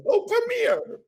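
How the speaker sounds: very high, gushing welcome; mother-in-law greeting. Retold or imitated. Imitating the mother-in-law who hugs everyone, high and gushing. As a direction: coo like an eager hugger. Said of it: "Mother-in-law is like".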